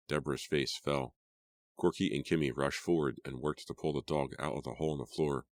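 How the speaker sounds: ordinary delivery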